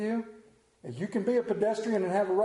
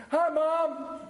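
star greets mom; 10 semitones higher, 3 dB louder than usual. Retold or imitated. Star waves to camera.

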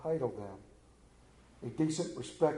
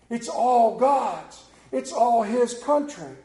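slow, higher, weighty declaration; slow emphatic; 8 semitones higher, 9 dB louder, much slower than usual. Real, not acted. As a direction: lean on each word, slowly.

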